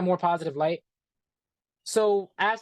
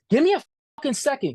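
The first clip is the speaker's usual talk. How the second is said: higher, fast, imitating his irritated outburst; quoting the boss who cut him off, a fast irritated outburst; 6 semitones higher, 3 dB louder, faster than usual. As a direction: fire it off like a fed-up boss, quick and impatient. Boss snaps at interviewer.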